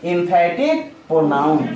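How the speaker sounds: very slow, each syllable drawn out; slow drill answer. Shown as a demonstration. Teacher drilling the term very slowly. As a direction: spell it out slowly for the class. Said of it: "myself क्या हो जाएगा?".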